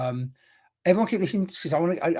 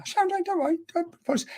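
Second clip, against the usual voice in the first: much higher, soothing don't worry; high reassurance; faster than usual. Real, not acted. Medium tells apologetic viewer Sharon not to worry, high reassuring. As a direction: reassure her lightly, pitched way up.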